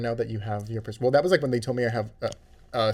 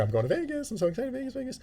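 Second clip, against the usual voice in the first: higher, giddy excitement; gushing; 13 semitones higher, 5 dB quieter, faster than usual. Retold or imitated. Retelling gushing to the dentist about Vegas, high and giddy. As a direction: gush like a kid before a trip. Imitating own excited chatter.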